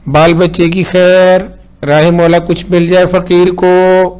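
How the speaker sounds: slow, loud beggar's plea; beggar calling. A narrator's reading. Beggar calling for alms at the door, slow and loud. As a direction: chant it slowly and loud like a street beggar.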